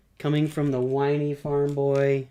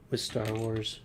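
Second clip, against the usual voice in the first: ordinary delivery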